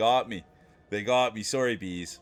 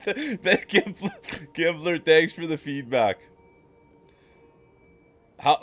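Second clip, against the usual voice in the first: ordinary delivery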